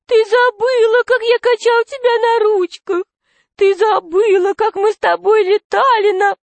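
high falsetto wheedling fat man; whiny, tearful voice. A narrator's reading. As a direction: plead plaintively in a thin, high whine. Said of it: "сказал толстяк плаксивым голосом".

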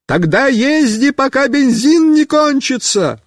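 high yelled advice; shouting. Acted. Mishka yells careless advice to rider, shouting. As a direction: shout it across the yard.

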